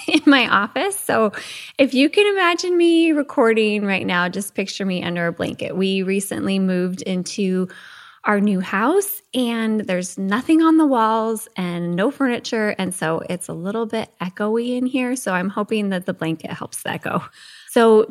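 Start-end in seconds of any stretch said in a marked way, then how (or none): none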